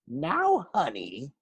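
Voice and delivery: syrupy "Now, honey" with a sting; sugary teacher voice masking a threat; fake sweet. A narrator's reading. Math teacher to a student, sickly sweet. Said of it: "real sweet".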